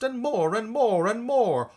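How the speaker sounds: building excitement. A narrator's reading. Oysters swarming ashore.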